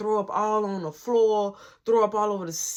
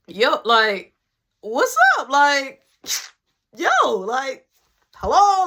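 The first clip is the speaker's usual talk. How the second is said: loud, high, over-eager yo; awkward greeting; 3 semitones higher, 5 dB louder, slower than usual. Retold or imitated. Greeting the girl at the door, loud awkward hello. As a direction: cover the awkwardness with a big hello.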